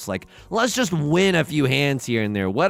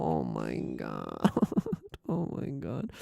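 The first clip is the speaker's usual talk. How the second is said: groaning oh my god; 9 dB quieter, much slower than usual. Real, not acted. After dealing in.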